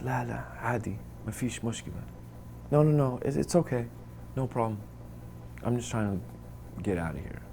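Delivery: soft, nervous declining of the gold; scared quiet refusal. Retold or imitated. Frightened prisoner refuses the guard's gold. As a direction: decline meekly, wanting out. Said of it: "I was so scared".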